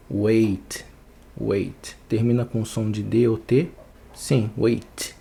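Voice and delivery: ordinary talk, nothing marked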